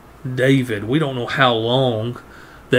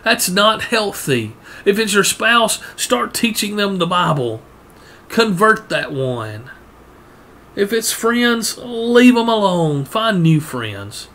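fiery preaching; 8 semitones higher than usual. Acted. Preacher urging the congregation away from sinful friends, fervent and raised. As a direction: build the sermon to a fervent peak.

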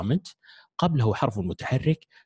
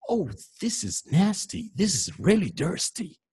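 higher, very slow, overdone disgust; exaggerated disgust; 8 semitones higher, much slower than usual. Shown as a demonstration. Teacher showing students exaggeration, hammed-up disgust. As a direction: ham up how gross it is, stretching every word. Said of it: "فنقول".

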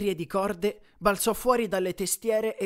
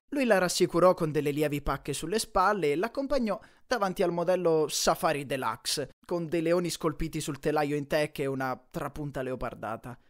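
usual speaking voice

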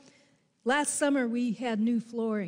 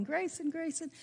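calling the cat; 6 semitones higher, 5 dB quieter than usual. Retold or imitated. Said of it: "looking around and calling".

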